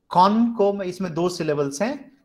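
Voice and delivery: ordinary talk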